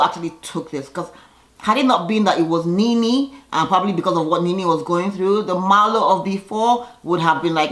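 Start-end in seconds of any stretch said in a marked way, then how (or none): none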